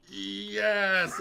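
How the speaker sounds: loud, high cartoon yes; mock cheer. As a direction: cheer the win like a cartoon chef. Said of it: "the Mung Dal going like".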